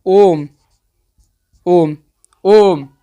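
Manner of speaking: "ओम" with a long held "ओ"; resonant drawn-out vowel. Shown as a demonstration. Teacher showing learners the longest vowel, drawn-out "ओम". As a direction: let "ओम" ring out, stretched to triple length. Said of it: "यानी आवाजवा गूंज रहा है".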